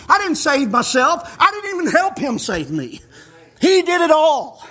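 ordinary talk, nothing marked

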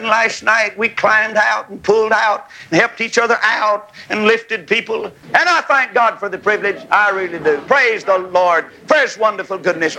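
ordinary delivery

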